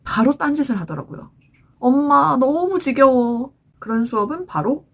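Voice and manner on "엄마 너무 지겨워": slow, louder whine imitating a kid; bored child. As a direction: drag it out like a child complaining to mom. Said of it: "아이가".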